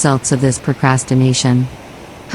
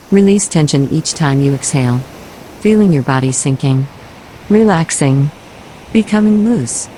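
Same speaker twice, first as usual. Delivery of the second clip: guided relaxation. Acted. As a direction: ease the listener into letting go, breath by breath.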